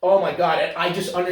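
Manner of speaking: ordinary talk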